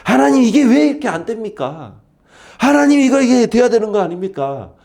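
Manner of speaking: high, pleading sulk to God; whiny complaint. Acted. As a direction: complain to God like a sulking child. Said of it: "하나님께 투정 부리면 됩니다".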